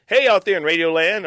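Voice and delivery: mock broadcast holler; hammed-up radio announcer. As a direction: belt it out like a cheesy DJ.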